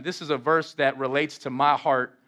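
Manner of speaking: usual speaking voice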